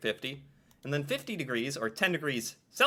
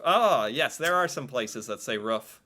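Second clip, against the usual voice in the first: ordinary delivery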